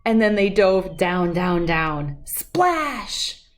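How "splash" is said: usual speaking voice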